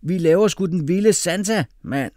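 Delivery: put-on loud, high boyish yell; rowdy, high-pitched announcement; excited shout. A narrator's reading. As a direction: holler it with gusto. Excited classmate shouting the plan.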